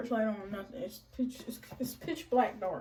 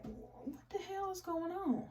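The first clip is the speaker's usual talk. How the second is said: exasperated; 8 semitones higher, 9 dB quieter, slower than usual. Retold or imitated. Angry wife at 2 a.m.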